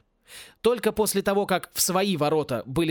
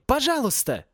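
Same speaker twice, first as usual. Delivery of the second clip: high-pitched pleading call; crying out; 9 semitones higher than usual. A narrator's reading. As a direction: cry it out high, begging for help. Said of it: "крикнул Порри".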